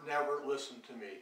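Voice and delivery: usual speaking voice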